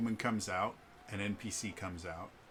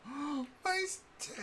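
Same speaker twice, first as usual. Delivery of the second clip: put-on tearful, betrayed kid; slower than usual. Kid seeing his stick burning, mock tearful shock. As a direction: gasp into a high, tiny child's squeak. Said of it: "tears start to well up".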